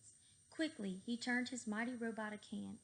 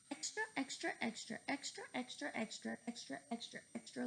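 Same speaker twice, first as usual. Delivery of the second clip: newsboy call fading; 2 semitones higher, 3 dB quieter than usual. Acted.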